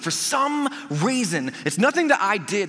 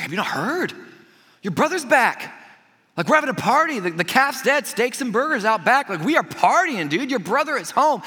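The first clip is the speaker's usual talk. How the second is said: high, animated party announcement; lively, higher-pitched modern-dude delivery; acted excited servant; 7 semitones higher, 3 dB louder than usual. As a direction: play a servant bursting with good news. Servant excitedly announces brother's return.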